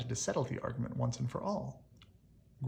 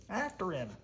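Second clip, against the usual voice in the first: higher urgent call; rally the others to chase; cried; 6 semitones higher than usual. Acted. The trio spurs each other after Monster, high and urgent. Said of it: "the trio cried".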